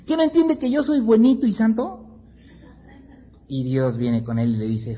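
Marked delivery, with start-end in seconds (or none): 0.1-2.2 much higher, mock self-righteous whine; preacher mimicking self-righteous Jonah. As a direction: lampoon his smugness. Mocking Jonás's self-righteousness.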